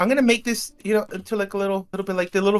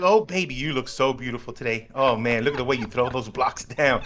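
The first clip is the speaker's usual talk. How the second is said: flirting; 8 semitones lower, 3 dB quieter than usual. Pretend. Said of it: "I even flirt with myself".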